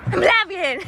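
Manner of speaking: high, gasping return to life; hammed-up high resurrection cry; mock movie revival. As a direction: burst back to life theatrically. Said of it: "Okay, movie death".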